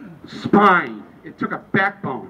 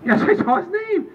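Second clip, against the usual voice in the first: much higher, exasperated reply; exasperated answer; 12 semitones higher than usual. Acted. Answering the same ballot question, high and exasperated. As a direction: insist in a high, worked-up voice. Acted exchange escalating.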